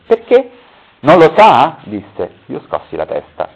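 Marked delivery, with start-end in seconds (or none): 0.0-0.5 surprised. Acted. Startled reply to a shout. 1.0-1.8 loud. Acted. Man rebuking her loudly.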